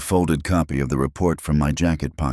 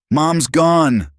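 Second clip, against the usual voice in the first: high, loud anguished outburst; voice cracking; 13 semitones higher, 6 dB louder than usual. A narrator's reading. Daughter to widowed father about Mom, voice cracking. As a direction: cry it out as the voice cracks. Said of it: "Jennifer's voice cracked".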